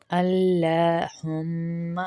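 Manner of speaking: اللہم with a full, heavy lam, slow and drawn. Shown as a demonstration. Teacher shows students the heavy lam in اللہم. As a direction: recite اللہم with the lam thickened. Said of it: "لام کو پتلا نہیں کریں گے".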